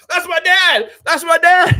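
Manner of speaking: high, loud imitation of a cheering son; excited son. Streamer imitating the governor's son jumping up, excited proud shout. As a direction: shout it high, mimicking a kid bursting with pride. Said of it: "his son started jumping up".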